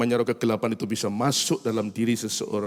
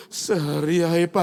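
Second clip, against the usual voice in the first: high, small pleading offer; child bargaining; 6 semitones higher, 4 dB louder, slower than usual. Retold or imitated. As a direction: bargain like a cheeky kid. Son bargains for one day.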